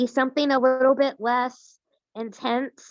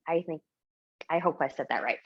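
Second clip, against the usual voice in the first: usual speaking voice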